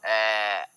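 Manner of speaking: low, drawn-out open "ए", as for a doctor; "ए" held as an open ae, pitched low; doctor's tongue-check sound. Shown as a demonstration. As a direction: stretch "ए" as if showing your tongue. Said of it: "जैसे बोलता है doctor, अपना जीभ दिखाओ".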